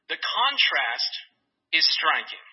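normal talking voice

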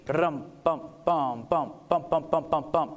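low vocal drum march; drum cadence vocalized. Shown as a demonstration. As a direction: beat out a march with your voice. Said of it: "A march, a drum march, a cadence".

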